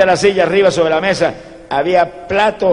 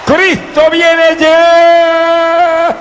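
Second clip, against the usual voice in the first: high, long-drawn shouted proclamation; full-force preacher's cry; prophetic shout; 9 semitones higher, 3 dB louder, much slower than usual. Retold or imitated. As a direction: shout it out to the whole crowd, stretching the last word. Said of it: "que le grita a mi pueblo".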